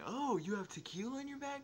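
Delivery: mock surprise. Kids taunting to alert principal.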